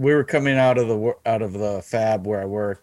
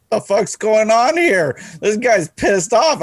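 confused; 9 semitones higher, 3 dB louder, slower than usual. Retold or imitated. New guy confused by the outburst.

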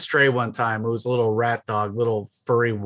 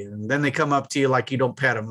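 usual speaking voice

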